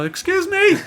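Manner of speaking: very high, timid squeak; squeaky voice. Retold or imitated. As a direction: pipe up in a tiny high squeak. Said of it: "this real squeaky voice".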